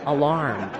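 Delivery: aLARM, short weak a; stress on second syllable. Shown as a demonstration. Teacher returns to the right form, second-syllable stress. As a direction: alarm with the weight on LARM. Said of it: "So you don't say alarm".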